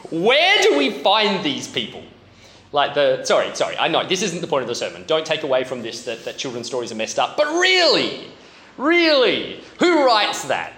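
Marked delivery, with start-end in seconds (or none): 0.1-2.0 incredulous. Real, not acted. Mock outrage at the fish story. 7.4-10.7 incredulous outcry. Real, not acted. Mock outrage at children's book.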